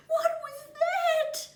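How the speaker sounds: high, loud, slow terrified cry; a scared little kiwi shrieks at a noise in the dark; frightened scream. A narrator's reading. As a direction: squeal it out in fright, small and scared. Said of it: "screamed Kiwi".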